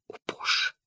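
gasped, unvoiced oh God; shocked whisper. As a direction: whisper it in horror. Husband shocked by confession.

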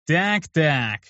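drawled nasty street-thug voice; a hint of a sneering thug; grating voice. A narrator's reading. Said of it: "противным голосом гопника протянул".

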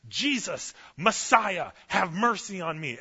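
loud, high, desperate cry for mercy; desperate scream. Retold or imitated. Blind man screams to Jesus over the crowd, loud desperate cry. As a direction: call out over the crowd like your life depends on it. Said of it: "He is screaming out like he's in labor".

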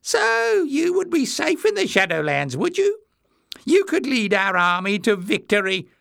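high-pitched mock admiration; amused mockery. A narrator's reading. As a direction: flatter mockingly in a very high voice. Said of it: "eyes were glittering with amusement".

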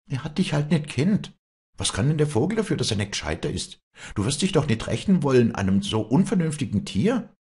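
high, hurried appeal; pleading. Acted. Walli excuses the unreasoning bird to Josef, high pleading. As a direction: reason with him anxiously.

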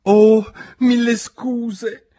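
high, loud, drawn-out apology; mortified exclamation. A narrator's reading. Alice has knocked over the jurors, mortified. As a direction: cry out in flustered embarrassment. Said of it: "esclamò tutta mortificata".

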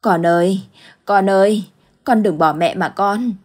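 loud, desperate cry for a lost daughter; desperate calling. Acted. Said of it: "cất tiếng gọi". (tiếng gọi con tuyệt vọng, to rồi chậm lại)

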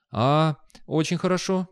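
high, helpless agreement. A narrator's reading. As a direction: agree helplessly, swept along. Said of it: "беспомощно отозвался Виктор".

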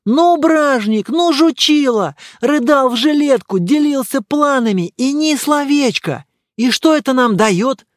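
high, loud, excited character voice; worked-up, loud astonishment; amazed outburst. Acted. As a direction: exclaim indignantly, pitch held up high. Добродеев reacts amazed to letter.

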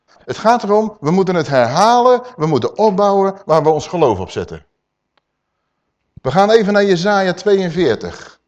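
ordinary delivery

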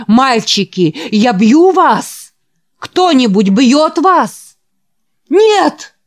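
slow, loud, high challenge; challenging shout. Acted. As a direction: dare them to answer. Preacher challenges, boys roar. (вызывающий возглас)

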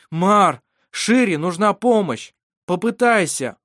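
loud, high urging; urgent appeal. A narrator's reading. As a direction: urge him loudly to act. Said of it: "Вассар попробовал ещё раз". (громкий настойчивый призыв)

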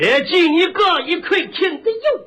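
high, slow Shandong dialect; exaggerated Shandong pronunciation; Shandong accent. Shown as a demonstration. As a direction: Shandong butcher repeating the order, vowels drawn out. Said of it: "他管拉不叫拉".

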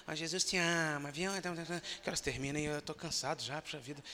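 soft, weary church member grumbling; a hint of a tired volunteer asking to quit; lightly voiced reluctant evangelist; tired grumble.

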